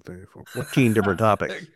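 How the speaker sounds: ordinary talk, nothing marked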